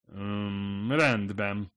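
hesitant "Öm, rendben" with a halting pause; nervous stammer, backing away. A narrator's reading. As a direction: stammer it out, taken aback.

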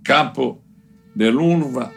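usual speaking voice

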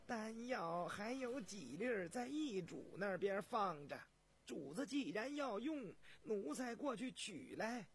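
high, slow, careful servant voice; high-pitched, drawn-out deference; deferential palace servant. Acted. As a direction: offer humbly to fetch it. Servant offers to fetch pills.

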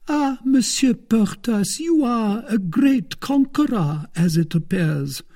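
lifted and slowed, teasing; very high coaxing wife. A narrator's reading. Wife teasing Porthos about his conquests, high and slow. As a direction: flatter him in a high voice.

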